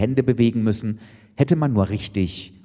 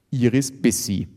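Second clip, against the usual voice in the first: ordinary delivery, nothing marked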